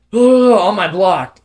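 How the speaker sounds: drawn-out, loud mock bellow; angry neighbor. Neighbor confronting a parked driver at night, mock angry.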